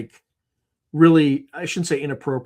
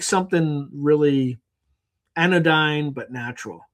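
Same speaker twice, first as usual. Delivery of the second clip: ordinary delivery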